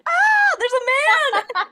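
high, loud shriek of fright; scared teen and wife screaming at a stranger; terrified scream. Retold or imitated. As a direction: scream it like you've seen an intruder. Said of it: "both just like".

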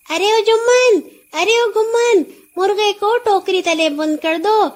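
high, loud call to the servants. A narrator's reading. Nora calls servants to tie up the horse, loud call. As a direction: call across the yard to the boys. Calling servants across the yard.

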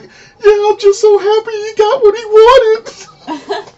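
high, loud, slow wailing imitation; mock crying. Imitating a relative crying over Ralphie's present, mock crying. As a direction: blubber it with hammed-up happy tears. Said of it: "just crying so much".

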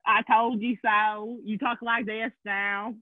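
slow, drawn-out Southern drawl; Southern accent. Retold or imitated. Friend teases her about regressing, in a slow Southern accent. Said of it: "regressing back to your Southern accent ways".

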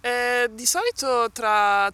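ordinary talk, nothing marked

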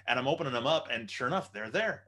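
ordinary talk, nothing marked

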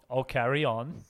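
ordinary talk

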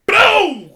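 loud, high "Boom!" punched out; slam sound effect. Retold or imitated. Describing hitting the mat, loud mock impact sound. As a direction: bark the impact like a crash. Imitating being slammed down.

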